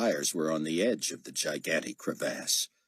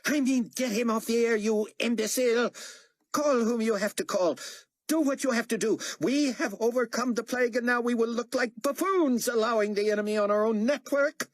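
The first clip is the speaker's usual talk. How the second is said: high, loud furious tirade; unhinged ranting fury; exploding in rage; 4 dB louder than usual. A narrator's reading. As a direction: explode at the imbecile. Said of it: "Carpathia exploded".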